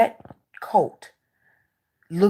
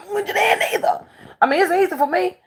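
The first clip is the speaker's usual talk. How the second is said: ordinary talk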